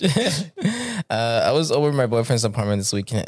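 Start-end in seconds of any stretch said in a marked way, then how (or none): 0.0-0.9 laughing echo. Real, not acted. Echoing the title word.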